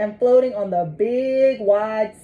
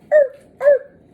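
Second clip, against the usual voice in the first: high barking seal noises; 7 dB louder than usual. Acted. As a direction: bark like a seal. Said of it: "A seal barks at it".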